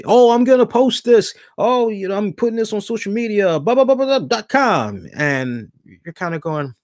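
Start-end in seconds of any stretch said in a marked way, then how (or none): 0.0-5.1 high, hammed-up imitation of the plugger; mocking the young guy. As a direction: parody his website plug, pitched up and mocking. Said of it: "the last thing you hear this young brother say".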